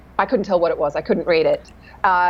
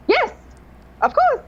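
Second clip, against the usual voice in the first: very high, squeaky agreement; over-eager yes. Retold or imitated. Coach bluffing to an actor who asks for help, squeaky eager yes. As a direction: pipe it up high, too willing. Retells agreeing while secretly unprepared.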